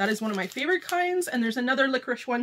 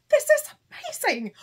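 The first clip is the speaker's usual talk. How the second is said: very high squeal of delight; real high-pitched thrilled exclamation; amazed; 12 semitones higher, 4 dB louder than usual. Real, not acted. Unwraps last book, amazed.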